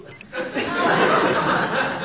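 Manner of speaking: breathy giggle; giggling laugh. Real, not acted. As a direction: burst out giggling. Phone vibrates, she laughs.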